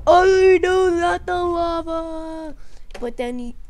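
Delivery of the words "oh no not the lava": high, slow, drawn-out wail; hammed-up wailing panic; mock dread. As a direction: play a doomed figure dreading the fall. Android falling into lava.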